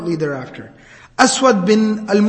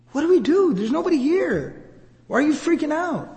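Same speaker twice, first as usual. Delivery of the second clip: high, light-voiced worried bewilderment; helpless bystanders; 7 semitones higher than usual. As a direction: ask helplessly in an acted, pitched-up voice. Bystanders helpless at man's panic.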